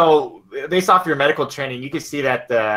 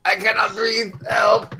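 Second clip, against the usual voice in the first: put-on desperate old man; 9 semitones higher, slower than usual. Old man crying out to the crowd, desperate. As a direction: cry out for help, choking. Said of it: "you hear the old man".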